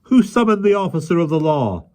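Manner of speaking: high, loud officious voice; official policeman voice. A narrator's reading. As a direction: announce himself pompously.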